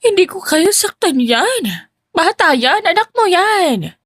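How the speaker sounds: slow, high tearful plea; crying plea. A narrator's reading. Stepmother crying to her husband, slow tearful plea. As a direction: beg through put-on tears.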